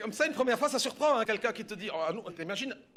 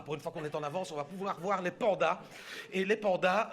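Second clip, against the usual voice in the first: ordinary delivery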